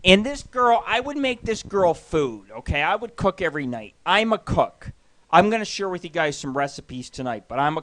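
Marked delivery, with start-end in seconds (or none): none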